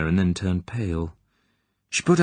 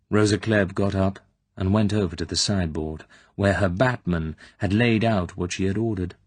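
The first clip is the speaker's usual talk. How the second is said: ordinary delivery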